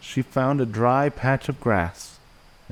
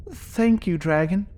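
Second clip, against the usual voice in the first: high little wolf-pup voice; little wolf character; 9 semitones higher than usual. Acted. Willow turning down the dragon's home, high little voice. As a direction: keep it small and high-pitched.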